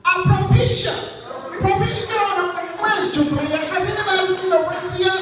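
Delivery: put-on mother yelling for her children in the food court. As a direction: holler the kids' names. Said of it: "mother ... yelling at her children".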